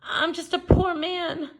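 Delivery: high, pleading whine; mock-pitiful leprechaun whine; whining leprechaun. A narrator's reading. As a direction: whine it like a caught little man. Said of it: "wiggled and twisted and whined".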